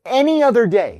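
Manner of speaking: "any other day" pushed high and loud; emphatic. Real, not acted. Explaining why he snapped, "any other day" loud and emphatic. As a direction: hit "any other day" hard, pitch jumping up. Stresses his regret over reaction.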